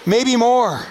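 normal talking voice

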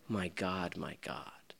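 broken whisper of abandonment; whispered cry. Acted. Jesus forsaken on the cross, whispered. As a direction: breathe the words out with no voice.

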